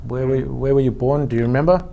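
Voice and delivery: ordinary delivery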